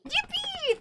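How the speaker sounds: tiny high squeal of joy; squeaky cheer. Real, not acted. Thanked by chat.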